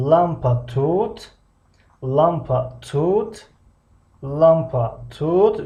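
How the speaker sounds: slow question with the voice rising up on тут. Shown as a demonstration. Teacher modelling a German question for the class, slow and rising. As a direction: draw out "Лампа тут" and lift тут into a questioning rise. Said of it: "teacher drills question tune".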